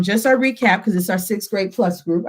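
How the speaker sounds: ordinary talk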